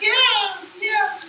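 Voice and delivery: put-on very high, slow sing-song little girl voice; a demon faking a sweet child; fake little girl. Spirit posing as child.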